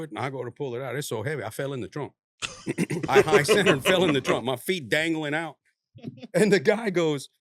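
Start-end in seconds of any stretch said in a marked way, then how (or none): 3.1-7.3 laughing loudly through the story; cracking up while telling it. Real, not acted. Laughing while telling friends about falling in the trunk.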